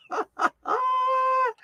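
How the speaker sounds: sudden high squeal of a laugh; tickled high laugh; squeaky high laugh. Real, not acted. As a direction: burst into a squeaky giggle. Laugh after joke.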